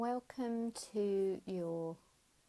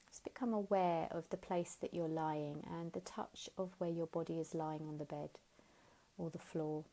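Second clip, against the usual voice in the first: guided meditation. Acted. Teacher guiding listeners lying down, gentle guided meditation. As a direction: ease them into stillness, unhurried. Leads a Yoga Nidra relaxation.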